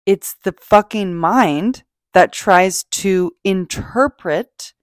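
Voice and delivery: normal talking voice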